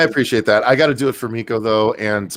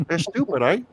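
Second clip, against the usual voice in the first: higher, playful Canadian "eh"; mock Canadian accent; 7 semitones higher, 4 dB quieter than usual. Friends joking about dumb critics, in a mock Canadian accent. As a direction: toss off a jokey Canadian accent. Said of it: "let me say it in Canadian".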